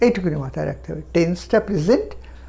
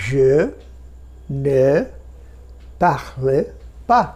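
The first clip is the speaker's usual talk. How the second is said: word-by-word drill; 4 semitones lower, much slower than usual. Shown as a demonstration. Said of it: "এখন বলবো".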